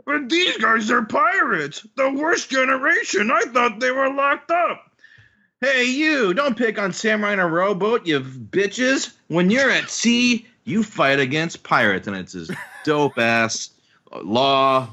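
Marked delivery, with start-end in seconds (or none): 0.0-4.8 high-pitched theatrical dismay; alarmed villain. Acted. Henchman seeing the pirates arrive, alarmed cry. 5.6-12.1 high-pitched hammed-up heroic taunt; defiant challenge. Pirate challenging those who attack samurai, defiant call. Hero's challenge read in character.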